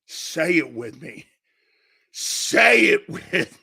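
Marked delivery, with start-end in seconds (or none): 2.1-3.5 a raspy loud shout of the chant. Real, not acted. Host yelling to his viewers to chant along. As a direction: yell it to rally the chat.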